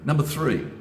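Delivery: emphatic announcement. Real, not acted. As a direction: announce it with weight to grab the audience.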